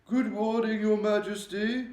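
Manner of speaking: put-on high, loud, polite old servant's greeting; a respectful older maid greeting the Queen; oldish maid. A narrator's reading. Maid entering the Queen's bedroom, polite old servant voice. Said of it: "the voice of an oldish person".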